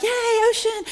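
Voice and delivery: high, sing-song cheer; mock cheer. Family jumping waves in the ocean, mock-cheerful and high. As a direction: squeal it like a kid at the beach.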